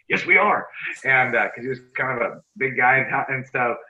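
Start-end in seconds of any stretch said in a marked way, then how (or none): 0.1-0.7 defiant insistence. Retold or imitated. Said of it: "I was like".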